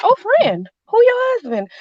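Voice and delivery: high, loud, sarcastic challenge. Retold or imitated. Wife retelling her reply to the other woman, sarcastic. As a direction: feign friendliness with a high, sarcastic lilt. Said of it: "friend is what I say when I'm being sarcastic".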